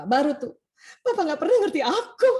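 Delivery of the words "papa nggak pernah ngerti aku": high, loud, laughing whine; hammed-up tearful complaint; whiny outburst. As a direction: burst out like a wounded wife. Wife finally bursting out complaint.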